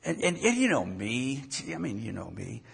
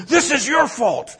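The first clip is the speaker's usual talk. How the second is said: high, loud indignant accusation; high huffy outburst; 11 semitones higher, 7 dB louder than usual. Retold or imitated. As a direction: snap it at him like an offended diva. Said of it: "she's so huffy".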